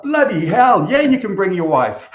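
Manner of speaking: loud, high mock outburst; exasperated exclamation. Boss answering candidate's request to bring his wife, exasperated outburst.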